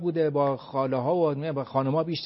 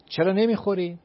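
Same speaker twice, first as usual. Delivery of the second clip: loud, high coaxing mother urging her to eat; 5 semitones higher, 8 dB louder than usual. Retold or imitated. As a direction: call out like a fussing mom pushing food. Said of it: "گفته که". (مادر دلسوز با صدای بلند و زیر)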